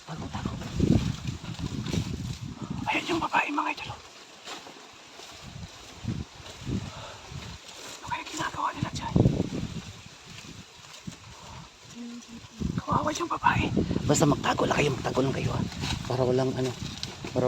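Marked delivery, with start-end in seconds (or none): 0.0-0.5 whispering while hiding. Acted. Urging others to hide. 2.9-13.7 whispering while hiding. Acted. Watching from hiding.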